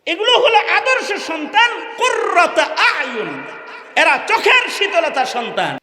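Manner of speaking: high, loud sermon cry; ringing high-pitched exhortation; impassioned preaching. Real, not acted. Preaches at full force.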